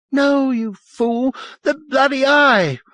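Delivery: very high, slow hysterical shriek; high-pitched exasperated scream; furious outburst. A narrator's reading. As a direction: shriek it at a fool, loud at the end. Furious woman corrects him.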